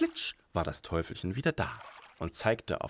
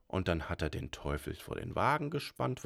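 ordinary delivery

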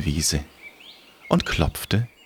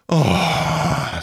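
grim reaper voice; 5 semitones higher than usual. Acted. Death voice.

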